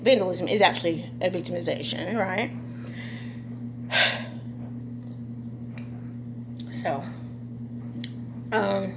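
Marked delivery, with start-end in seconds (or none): 2.6-6.7 long, soft, unvoiced exhausted sigh. Real, not acted. Vlogger sighing after the rant, worn out. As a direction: sigh deeply and let it drain.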